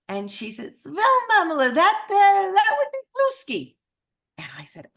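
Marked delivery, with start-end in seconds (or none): 0.8-3.8 loud, very high, hammed-up Eastern European accent; Muppet-chef take on an Eastern European accent; bad Eastern European accent. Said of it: "I do the absolute worst Eastern European accent".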